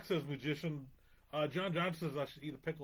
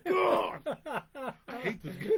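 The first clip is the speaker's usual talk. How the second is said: louder and higher, laughing exasperation; 11 semitones higher, 5 dB louder, slower than usual. Real, not acted. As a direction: laugh it out in defeat. Loses point, bursts out laughing.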